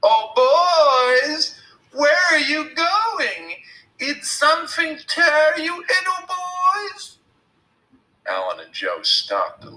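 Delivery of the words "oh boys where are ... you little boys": very high, loud, slow singsong call; shrill, girlish voice. A narrator's reading. Billy on the stump calling the boys, shrill and girlish. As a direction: taunt them in a mock little-girl voice. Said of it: "called in a shrill, girlish voice".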